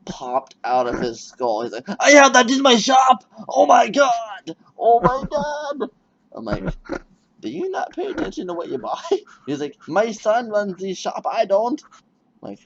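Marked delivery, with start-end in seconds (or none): 2.0-5.9 very high, loud, slow mock shriek of shock; hammed-up horrified shout; mock panicked shout. Clerk shocked by lyrics. 7.4-9.0 mock incredulity. Retold own retort. 9.9-11.8 high mock clerk. Retold clerk excuse.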